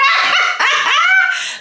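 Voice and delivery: high, loud shrieking laugh; big laugh. Real, not acted. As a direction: burst into a squealing cackle. Bursts out laughing.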